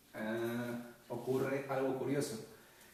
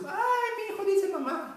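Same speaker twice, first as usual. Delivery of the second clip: much higher, louder thrilled mom; high, loud gushing mother's voice; delighted mother; 6 dB louder than usual. Retold or imitated. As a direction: squeal it like a proud mother. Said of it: "la mamá en su".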